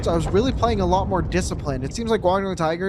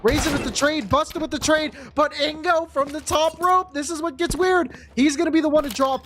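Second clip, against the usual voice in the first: higher and rising hype call; excited esports caster; 7 semitones higher than usual. Acted. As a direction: build the call up to the clutch. Round climax call.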